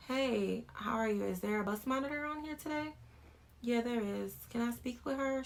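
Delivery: courteous, light-voiced request; polite. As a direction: ask gently and politely. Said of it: "So I politely get out the car".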